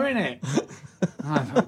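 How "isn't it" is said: hammed-up Cockney pub heckler, pitched way up; Cockney fan. Cockney stranger barging into the pub snug, high hammed-up Cockney. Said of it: "this Cockney comes in".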